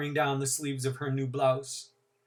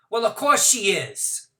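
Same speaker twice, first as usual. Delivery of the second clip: put-on loud, high, breezy young mother; gushing, careless Courtney; 6 semitones higher, 8 dB louder than usual. Courtney voiced.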